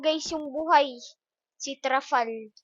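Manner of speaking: ordinary talk, nothing marked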